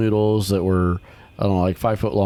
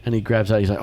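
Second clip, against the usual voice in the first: usual speaking voice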